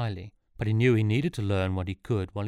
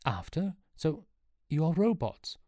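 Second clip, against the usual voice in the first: high-pitched startled questioning; taken aback, raised pitch; incredulous; 8 semitones higher than usual. A narrator's reading. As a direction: piece it together in disbelief. Sean taken aback by revelation.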